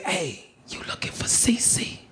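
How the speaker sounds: sly, hushed approach; retold whisper. Dude sidling up to the new inmate, whispered. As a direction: whisper it like a covert contact. Stranger furtively approaches him.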